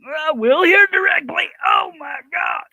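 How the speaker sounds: high-pitched cartoon squeak; squeaky high voice. Squeaky voice exclaiming.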